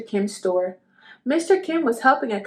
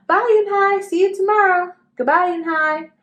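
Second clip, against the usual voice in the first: calling goodbye; 8 semitones higher, 5 dB louder than usual. Acted.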